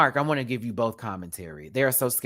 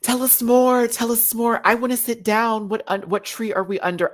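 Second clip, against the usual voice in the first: much higher and louder, eager; eager gossip; 9 semitones higher, 3 dB louder than usual. Retold or imitated. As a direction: beg for the tea, thrilled. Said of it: "I'm like".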